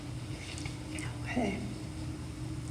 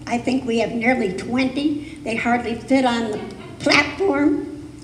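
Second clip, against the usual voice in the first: ordinary talk, nothing marked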